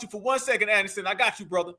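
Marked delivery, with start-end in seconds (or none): none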